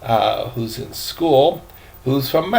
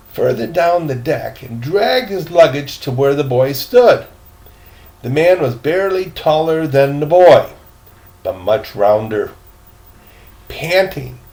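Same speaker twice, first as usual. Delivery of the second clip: lively storytelling read aloud, rising as the dialogue builds; story narration; 3 semitones higher, 5 dB louder than usual. Acted. Grandpa reading a story to the grandkids, lively storytelling. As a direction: read the adventure like grandpa to the kids.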